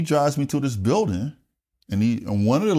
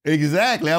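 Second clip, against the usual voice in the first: usual speaking voice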